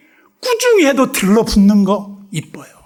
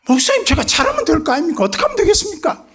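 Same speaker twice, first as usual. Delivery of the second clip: fast, higher pleading member voice; eager church member; 10 semitones higher, faster than usual. Retold or imitated. Church member promising the pastor to do better, fast eager plea. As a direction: rush to please the pastor.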